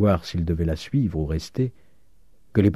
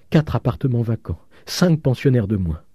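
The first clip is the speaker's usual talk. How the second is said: higher-pitched, stunned tally; mildly raised, aggrieved lament; lamenting losses; 5 semitones higher than usual. A narrator's reading. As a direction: count each loss in dismay. Landlady counts her losses.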